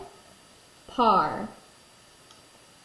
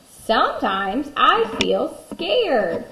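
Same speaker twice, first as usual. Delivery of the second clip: slow, louder storybook read; reading to a toddler; 4 semitones higher, 5 dB louder, slower than usual. Acted. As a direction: read it to little ones, slowly. Reads scared page.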